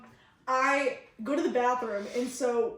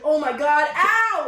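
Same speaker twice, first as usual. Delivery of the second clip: hammed-up pain; 3 semitones higher, 7 dB louder, slower than usual. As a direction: play up the pain for laughs. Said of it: "playing up for laughs".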